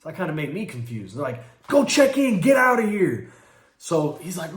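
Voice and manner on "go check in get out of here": retold shout. Attackers ordering the beaten man out.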